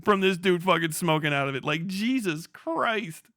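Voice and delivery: normal talking voice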